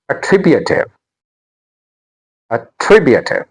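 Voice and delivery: "attributive" with TRIB made prominent; stress on second syllable. Shown as a demonstration. Teacher modelling another word, "attributive" stressed a-TRIB-utive. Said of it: "The stress is on T".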